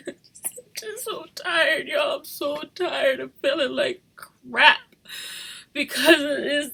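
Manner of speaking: slow, sobbing and breaking; crying. Real, not acted. Mother telling viewers she is tired, sobbing. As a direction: weep it out slowly. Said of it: "hear me crying".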